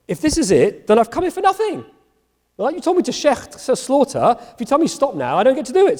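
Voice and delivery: high, whiny protest to God; protesting Abraham. Acted. Abraham voiced arguing with God.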